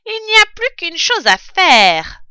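voix de brute, plus aiguë et bien plus forte; fermier rusé. Acted. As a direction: annonce le plan d'un ton sinistre. Bin annonce son plan. (Bin annonce son plan aux autres)

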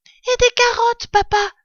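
put-on very high, loud child voice; littlest fox cub. Youngest cub asking his father, high little voice. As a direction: pipe up like a small child. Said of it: "dit le plus petit des trois renardeaux".

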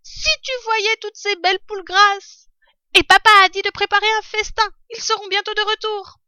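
high, loud little fox cub, excited; a young cub bursting with news; eager fox cub. A narrator's reading. Fox cub waking his starving mother, eager high voice.

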